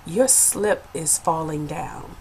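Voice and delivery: usher's discreet whisper, low and slow; imitated whisper. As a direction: murmur it softly, as if into someone's ear. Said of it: "she whispers in my ear".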